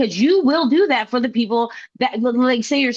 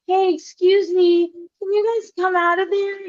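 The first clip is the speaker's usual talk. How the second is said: overly polite; 5 semitones higher than usual. Retold or imitated. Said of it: "are you gonna be like".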